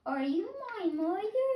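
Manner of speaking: high, small chick voice, lost and asking; a baby bird piping for its mother. Acted. Hatchling searching for its mother, high piping voice. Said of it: "my baby bird voice".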